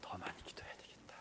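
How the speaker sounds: faint unvoiced whisper, stress on 더; whispered resolve. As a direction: murmur 더 많이 기도해야 되겠다 barely audible. Repeated inner resolve, whispered.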